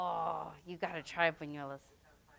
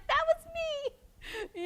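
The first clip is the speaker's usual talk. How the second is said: excited quote; much faster than usual. Retold or imitated. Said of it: "I'm like".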